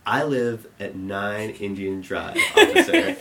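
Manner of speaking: slow, over-clear retold announcement; loud, clear voice. Teen telling a cop his address, loud and clear. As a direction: enunciate every word slowly, imitating a friend. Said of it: "his loudest, clearest voice is like".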